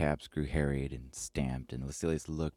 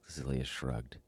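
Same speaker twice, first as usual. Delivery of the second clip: ordinary delivery, nothing marked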